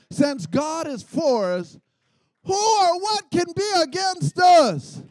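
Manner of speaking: emphatic, rising to a shouted challenge; emphatic preaching. Real, not acted. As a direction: declare it with full emphatic force. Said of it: "you gotta be emphatic about it".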